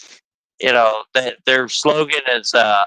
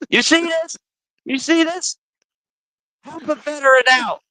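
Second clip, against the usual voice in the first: higher, teasing pitch; playful hype; 12 semitones higher than usual. Real, not acted. Host hyping the coffee sponsor to viewers, higher playful voice. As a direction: sell it to the camera.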